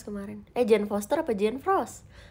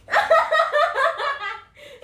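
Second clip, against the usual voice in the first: long high-pitched laugh; 7 dB louder than usual. Real, not acted. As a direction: let out a high squealing laugh. Laugh alone on line.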